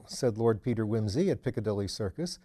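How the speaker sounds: ordinary delivery, nothing marked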